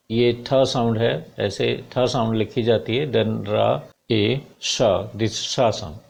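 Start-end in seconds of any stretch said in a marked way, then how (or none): none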